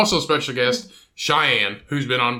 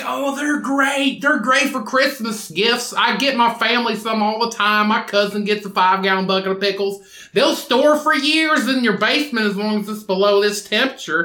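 imitating a woman; 7 semitones higher than usual. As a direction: squeak it out like an enthusiastic aunt. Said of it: "She's like".

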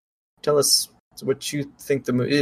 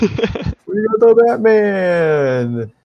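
high, booming wrestling ring announcer; 9 semitones higher, 3 dB louder, much slower than usual. Pretend. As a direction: hype up the fighters like a ring announcer. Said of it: "Do it in an announcer voice".

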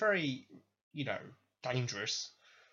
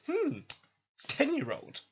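a high, musing mock Steven; high mock champion; 13 semitones higher, 4 dB louder than usual. Champion eyeing a ten-year-old, high and musing. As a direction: pitch it up and size up the kid. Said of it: "and goes".

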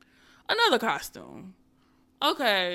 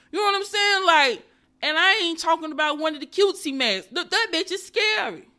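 worked-up; 7 semitones higher, 5 dB louder than usual. Real, not acted. Scared by mask story.

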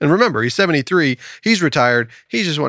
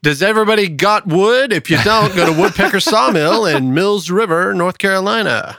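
higher-pitched sponsor pitch; upbeat, raised-voice commercial read; 6 semitones higher than usual. Acted. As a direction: pitch it brightly like a local radio spot. Reads a sponsor spot.

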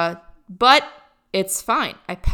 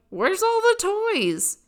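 imitating her younger self, pitched up high; a disappointed kid asking where the toys are, pitched up; mock childish complaint, much higher; whiny kid; 11 semitones higher than usual. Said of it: "and I'm like".

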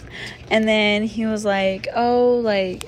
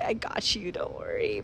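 breathy, barely voiced I got you; hushed fake-calm promise; whispered reassurance; 10 dB quieter than usual. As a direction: say it under your breath, faking calm. Reassuring while panicking inside.

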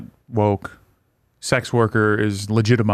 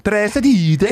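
sing-song Muppet chef gibberish on the word Prostitute; repeating the joke word, a Swedish Chef impression; Swedish Chef accent; 10 semitones higher than usual. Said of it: "the chef from the Muppets".

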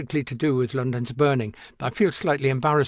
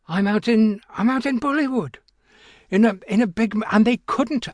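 amazed; 8 semitones higher, 4 dB louder than usual. Real, not acted. Amazed at being in Bollywood.

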